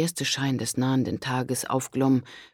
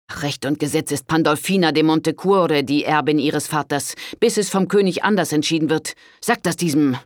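angry; 3 semitones higher, 6 dB louder than usual. A narrator's reading. Richard defends the heir.

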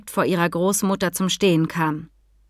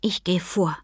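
soft, breath-only whisper; 4 dB quieter than usual. A narrator's reading. Mika bends to her horse's neck, whispering. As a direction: whisper it close into an ear. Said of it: "flüsterte sie".

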